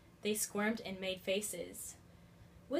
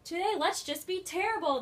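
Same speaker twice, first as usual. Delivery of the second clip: put-on mischievous kids; 8 semitones higher, 5 dB louder than usual. A narrator's reading. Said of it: "they said".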